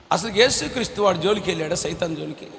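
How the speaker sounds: normal talking voice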